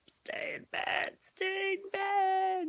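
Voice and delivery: put-on begging the toddler to stay in bed, slowly; whispered plea to toddler. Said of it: "I'm like".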